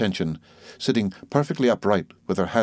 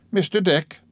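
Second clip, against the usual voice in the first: brisk aunt; 10 semitones higher than usual. Acted. Aunt voiced in story.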